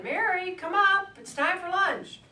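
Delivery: high, loud call from a window. Retold or imitated. Mother calls her daughter a second time from the window, yelling. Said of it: "this woman yelled down".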